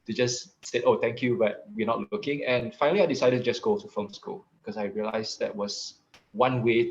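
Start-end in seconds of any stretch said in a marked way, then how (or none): none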